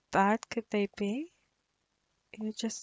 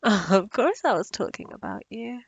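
teasing laugh; 7 dB louder than usual. Acted. Teasing her mate.

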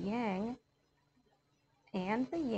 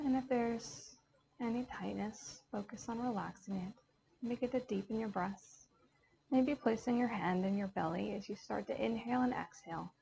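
calm yoga teacher counting out a box breath; guided meditation. Acted. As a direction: talk the class gently through each breath.

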